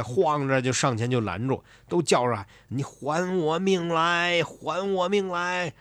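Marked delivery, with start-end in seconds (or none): 2.7-5.7 very slow, high, drawn-out ghost moan; wronged ghosts demanding their lives back; ghostly wail. Acted. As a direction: moan it like a vengeful spirit. Vengeful ghosts block the emperor.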